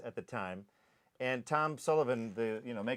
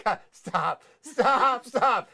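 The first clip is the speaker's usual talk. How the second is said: high, loud pleading through laughter; laughing plea; 10 semitones higher, 8 dB louder, much slower than usual. Retold or imitated. Cornered with sticks, begging friends through laughter. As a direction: beg them to stop while laughing. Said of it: "and I'm going".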